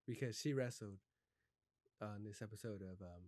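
normal talking voice

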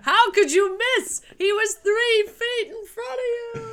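high, loud mock yell; hammed-up shouting quote. Quoting Mulan scene, shouting.

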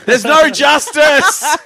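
loud, high, slow wail through a laugh; mock shout. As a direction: cry it out like a court clerk losing hope. Imagined court roll call.

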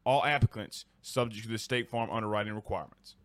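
low, soft, steady sponsor read. Acted. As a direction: read the ad like a radio spot. Reads the sponsor ad.

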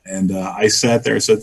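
ordinary delivery

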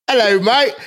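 a hammed-up British hello; mock British accent. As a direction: greet like a Cockney bloke. Using word in sentence, British.

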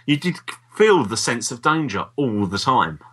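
normal talking voice

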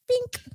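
high squeaky bink; silly sound effect. Real, not acted. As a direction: pop out a tiny high bink. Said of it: "I'm gonna make all crazy ass noises".

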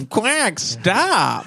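squeaky duck voice. Pretend.

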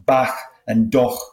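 "bach" and "doch" with velar fricative endings, slowly; Scouse back and duck, ending "bach" and "doch" in a throaty H; Liverpool fricative K. Shown as a demonstration. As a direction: end "bach" and "doch" on a strong H. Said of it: "some kind of congestion because of this".

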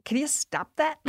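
high, soft, exasperated request; annoyed Benedict. Retold or imitated. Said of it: "Benedict was like".

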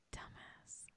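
whispered teasing dumbass; whispered insult. Real, not acted. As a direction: breathe it out under your breath.

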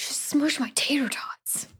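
soft playful whisper. Pretend. Joking to her co-host about tater tots in a pocket, whispered. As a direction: breathe it out as a mock lament.

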